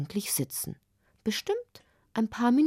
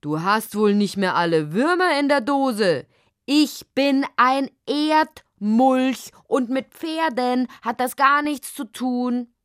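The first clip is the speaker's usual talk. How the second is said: furious; 4 semitones higher, 5 dB louder than usual. Acted. The mole furiously insists he is an Erdmulch. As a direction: blast the hedgehog with indignation. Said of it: "wurde er erst recht wütend".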